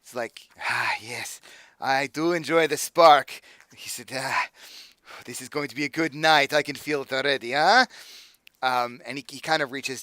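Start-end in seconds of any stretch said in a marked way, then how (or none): 0.7-3.4 a hint of a relishing accent, slow; lightly accented villain. Patron tasting the sparking vial, lightly accented relish. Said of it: "I love the accent". 4.2-7.8 put-on lightly accented villain. Patron tasting the sparking vial, lightly accented relish. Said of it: "I love the accent".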